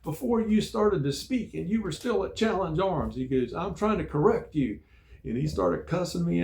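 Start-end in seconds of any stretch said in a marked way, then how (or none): 0.0-3.2 high, loud mock tirade; raised-voice scolding, pitched well up; retold screaming. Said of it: "he started screaming at me". 3.5-4.8 high, loud exasperated imitation; raised-voice mock scolding; retold screaming. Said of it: "he started screaming at me".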